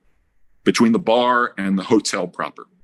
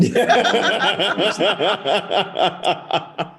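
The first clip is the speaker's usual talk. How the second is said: real high-pitched belly laugh; long laughter; 9 semitones higher than usual. Real, not acted. Listeners hearing how the prank ended, real laughter. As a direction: laugh helplessly at the punchline.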